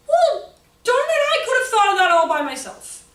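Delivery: high, loud, slowed mock outrage; hammed-up exasperated darn it; sarcastic exasperation. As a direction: throw it back sarcastically.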